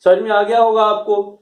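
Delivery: usual speaking voice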